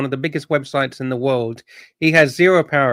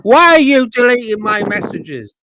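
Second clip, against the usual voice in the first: higher, louder whiny imitation; whiny mock voice; 5 semitones higher, 4 dB louder than usual. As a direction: whine it like a petty complainer. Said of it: "Dubs is gonna say".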